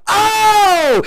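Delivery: high, startled "oh"; mock startled cry. Retelling opening the wrong door, mock startled. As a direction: play up the shock at an opened door. Retold walking in on someone.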